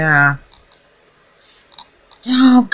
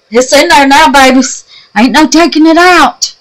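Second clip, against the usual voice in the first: gleeful; 7 semitones higher, 8 dB louder than usual. Real, not acted. Gleeful about adding heart.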